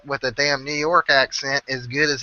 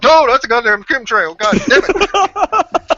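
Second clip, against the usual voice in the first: higher, louder, dragged-out angry outburst; mock chemtrail rant; 11 semitones higher, 7 dB louder, slower than usual. Imitating the friend yelling about a chemtrail in the sky. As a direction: ham up the conspiracy nut's outrage.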